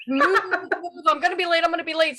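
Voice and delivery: hammed-up panic at the cars, not full volume; imitating a driver yelling move at traffic; mock screaming at traffic. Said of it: "screaming at cars".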